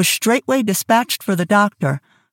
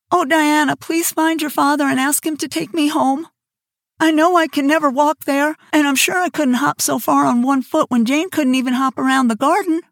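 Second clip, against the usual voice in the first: pleading Anne; 7 semitones higher than usual. Acted.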